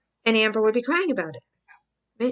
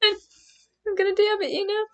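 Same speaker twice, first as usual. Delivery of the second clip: mock cry; 11 semitones higher than usual. Mock crying voice.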